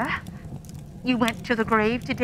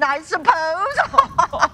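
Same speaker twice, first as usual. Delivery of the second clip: gloating cackle; 5 semitones higher, 4 dB louder, much slower than usual. Acted. Charwoman gloating over dead man's things.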